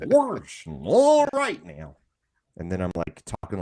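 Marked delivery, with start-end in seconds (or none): 0.1-2.0 high, slow cartoon whoop; Goofy. Showing co-hosts he is stuck in character, high and goofy. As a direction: stretch it out in a silly high cartoon voice. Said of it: "the whole day you talk like".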